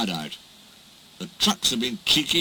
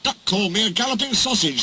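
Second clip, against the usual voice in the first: spluttering; 7 semitones higher, faster than usual. Acted. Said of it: "spluttered Gordon".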